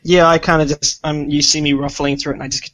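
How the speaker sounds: usual speaking voice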